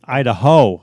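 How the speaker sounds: "Idaho" with a loud stressed last syllable; mock pronunciation of Idaho. Shown as a demonstration. Co-host jokingly correcting Iowa, mock pronunciation. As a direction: hit the HO in "Idaho". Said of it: "I believe it's pronounced".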